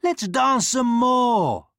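put-on high, loud, slow childish plea; eager prince pleading at full voice; begging. A narrator's reading. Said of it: "who begged".

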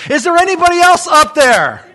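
high, loud punchline shout; desperate call upward. Acted. Man on the branch seeks another rescuer, desperate shout. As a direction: holler up past God for other help. Dangling man calls up for someone else.

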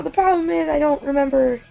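ordinary talk

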